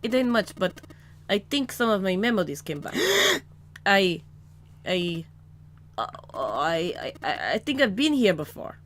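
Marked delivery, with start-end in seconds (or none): 3.9-4.2 startled gasp. Acted. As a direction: gasp in shock, then speak. Seven suddenly recalls the room.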